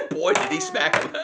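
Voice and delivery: high-pitched words through laughter; laughing hard. Real, not acted. Player cracks up at a smack in the game, laughing hard. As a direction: squeeze it out slowly while laughing.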